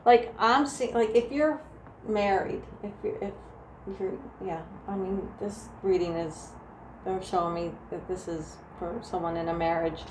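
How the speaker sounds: normal talking voice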